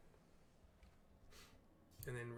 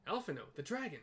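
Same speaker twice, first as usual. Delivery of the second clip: Alphinaud's name called out louder and higher; alarmed call; 5 semitones higher, 4 dB louder than usual. Acted. Cid calling to Alphinaud as the dragon wakes, loud and higher. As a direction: warn him the dragon's awake. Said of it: "says Cid".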